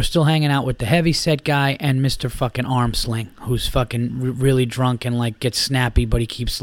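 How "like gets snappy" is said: normal talking voice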